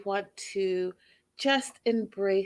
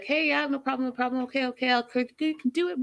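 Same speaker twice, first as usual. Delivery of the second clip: much higher, bright encouraging dad voice; cheerleader dad; 6 semitones higher, faster than usual. Retold or imitated. Vlogger mimicking a dad's easygoing cheerleading, much higher. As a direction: cheer them on like an eager dad. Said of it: "Dads will be like".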